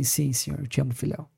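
resposta triste, mais baixa e mais suave; muito triste. Retold or imitated. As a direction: responde abatido, com a voz caindo. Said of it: "Daí ele, muito triste". (Pedro responde a Jesus, muito triste e baixinho)

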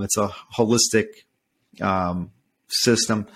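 ordinary talk

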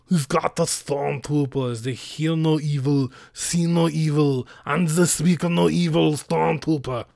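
silly put-on character voice. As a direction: chant it in a goofy, altered voice. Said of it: "I don't know why I'm doing the voice".

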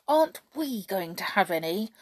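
plain reading voice

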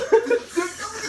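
high squealing laugh. Real, not acted. Laugh burst.

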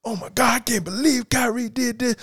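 high, excited mock outrage; super animated. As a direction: squeal it with over-the-top energy. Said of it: "He was super animated".

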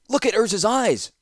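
high, loud urgent shout across the room. Acted. Ratapi shouting across the chamber to Zancha, loud and high. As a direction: yell it to be heard across the chamber. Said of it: "Ratapi shouted".

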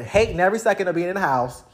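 ordinary delivery

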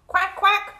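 loud, high quacks; two sharp, high duck calls; duck quacking. Acted. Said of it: "said the duck".

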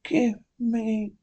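high, strangled and very soft, slow, gasping; suffocating. Acted. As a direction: choke out each word, running out of air. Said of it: "Begins to breathe heavily".

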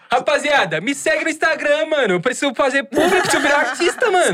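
high, laughing showman voice; wannabe influencer voice. Pretend. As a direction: hype it up like a wannabe star. Mock wannabe influencer after "Artista.

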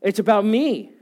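loud, rising preacher's build; full-force pulpit preaching, voice pushed high. Acted. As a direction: preach it to the whole room with fire.

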